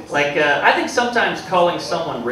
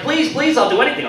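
high, louder mock pleading; desperate begging; 10 semitones higher, 5 dB louder than usual. Comic acts out one partner begging the other, desperate. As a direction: beg in an exaggerated high voice. Acted begging in retold exchange.

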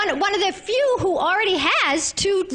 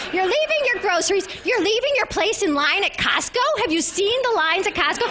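normal talking voice

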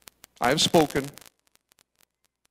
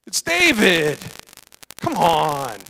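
louder, slower incredulous protest; louder, scoffing cry of disbelief; outraged brother; 6 semitones higher, 5 dB louder, much slower than usual. Retold or imitated. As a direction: exclaim it like a jealous older brother. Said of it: "The brother's going".

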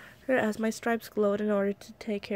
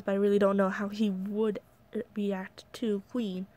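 plain reading voice

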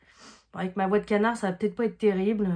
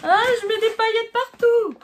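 excited exclamation; 12 semitones higher, 5 dB louder than usual. Real, not acted. Glitter spills, exclaiming.

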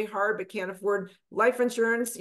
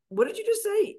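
stunned disbelief; 12 semitones higher than usual. Retold or imitated. Stunned reaction to Paul.

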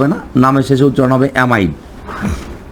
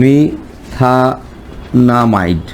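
"মিথানামাইড" drawn out very slowly in separate pieces; syllable by syllable; much slower than usual. Shown as a demonstration. Teacher writing out the compound's name, very slow "মিথানামাইড", syllable by syllable. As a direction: break "মিথানামাইড" into long syllables with pauses.

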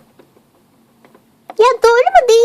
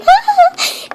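short high laugh; 11 semitones higher than usual. Acted. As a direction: burst into a brief, high laugh. Laughs after joking about hometowns.

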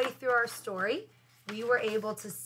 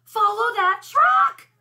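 high, loud, drawn-out storybook shout; crying out; 11 semitones higher, 11 dB louder, slower than usual. A narrator's reading. Buzz orders a chase after the truck, crying out. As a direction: call it out like an urgent order. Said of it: "cried Buzz".

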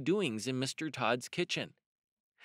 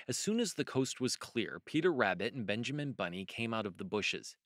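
ordinary delivery

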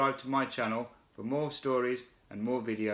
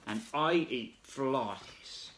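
slow proud; 5 dB quieter, much slower than usual. Acted. Frog's proud reply.

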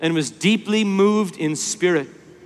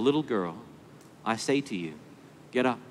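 soft and low, a tender wake-up; quiet, gentle voice to a sleeping child; ever so gentle; 7 semitones lower, 10 dB quieter than usual. Acted. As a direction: coax her gently awake. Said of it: "a voice that must have been ever so gentle".

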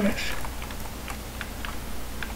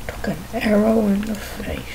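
whispered repeat of the punchline; 5 dB quieter than usual. Acted. Whispering the meme line to the viewers. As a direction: breathe it out as a joke.

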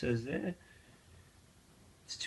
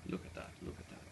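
awed whisper; 16 dB quieter, faster than usual. Real, not acted. Admiring the blue gas flame.

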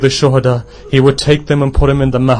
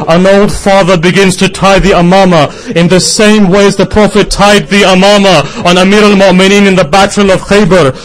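high, loud lamenting sermon cry; preaching at full force; 6 semitones higher, 5 dB louder than usual. Acted. Preacher to mourners recounting Hussein's farewell, loud high lamenting cry. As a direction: wail the farewell out to the mourners, pushing each line.

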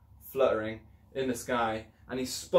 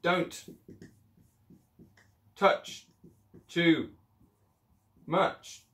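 bold superhero voice; 6 semitones higher, much slower than usual. Acted. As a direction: deliver it word by word like a hero. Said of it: "That's what you might say".

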